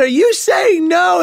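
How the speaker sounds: mock disbelief. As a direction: play his stunned self. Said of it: "I said".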